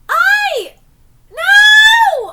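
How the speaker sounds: high, very loud yell of protest; shocked shout. Real, not acted. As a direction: scream no at the screen. Reacting to character leaving.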